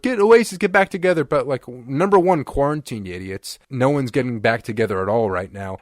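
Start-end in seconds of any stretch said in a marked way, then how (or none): 0.0-1.2 pleading fans. Retold or imitated. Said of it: "all these fans are like".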